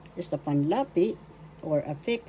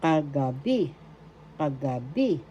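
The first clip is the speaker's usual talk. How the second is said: Kagabi with the accent on the final "bi"; stress on third syllable; 3 semitones lower than usual. Shown as a demonstration. Teacher showing learners where the accent is, stress on final "bi" of Kagabi. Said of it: "The accent is in the third syllable".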